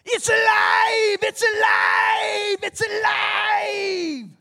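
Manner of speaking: high, slow, screaming mad scientist; mad doctor Frankenstein cry. Retold or imitated. Preacher imitating the Frankenstein doctor, screaming high and slow. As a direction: shriek it like the doctor whose monster wakes. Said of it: "What did the doctor do".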